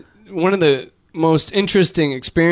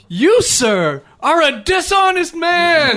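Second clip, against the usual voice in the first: higher, louder, slow accusing proclamation; mock public yell; 12 semitones higher, 3 dB louder, much slower than usual. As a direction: denounce him loudly to the whole restaurant. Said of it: "Yelled it out in front of everybody".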